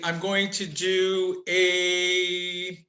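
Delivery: "going to do a" drawn out word by word; slow, careful restart. Real, not acted. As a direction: stretch out "I'm going to do a" very slowly. Restarts the same phrase slowly.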